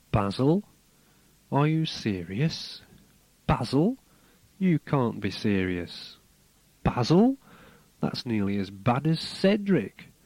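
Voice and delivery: emphatic, questioning poem recital, names lifting upward; poem reading. Acted. Reads poem extract aloud.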